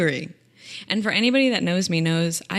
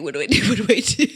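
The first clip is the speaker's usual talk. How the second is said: rising, louder comic dithering; mock panic; 2 semitones higher, 6 dB louder, much faster than usual. Client agonizing over an appointment date, mock panic. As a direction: build into a laughing mock panic.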